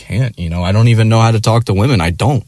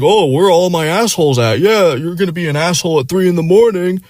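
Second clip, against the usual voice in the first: much higher, hyped-up crowd-work voice; hammed-up emcee; 10 semitones higher than usual. The owner hyping the bar crowd, mocked high and cheesy. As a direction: imitate a cheesy bar comic working the room.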